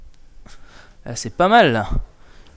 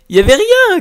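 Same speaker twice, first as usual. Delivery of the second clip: surprised; 9 dB louder than usual. Real, not acted. Finds nothing, exasperated.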